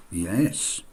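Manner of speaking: ordinary talk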